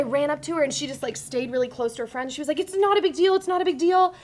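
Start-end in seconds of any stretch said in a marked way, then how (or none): 2.6-4.1 higher, louder retold protest; imitated upset brush-off. Crying girl waving off the apology, high-pitched insistence.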